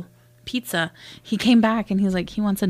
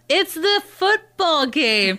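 high, drawn-out imitation of exasperation; exasperated girlfriend; 8 semitones higher, much slower than usual. Girlfriend explaining to her boyfriend, high and exasperated. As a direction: stretch each word at a clueless boyfriend. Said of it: "And she's all".

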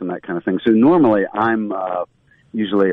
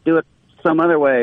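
higher mock director, correcting the take; director's note; 8 semitones higher than usual. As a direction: play the director stopping the actor, pitched up. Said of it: "the director's like".

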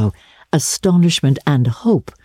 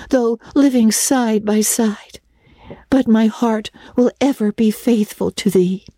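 solemn farewell; 7 semitones higher than usual. A narrator's reading. Anne parts forever from her beloved friend, solemn and mournful. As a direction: draw it out like a parting heroine. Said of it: "mournfully waving her hand".

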